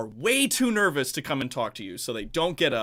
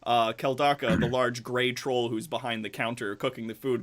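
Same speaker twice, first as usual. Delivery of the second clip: usual speaking voice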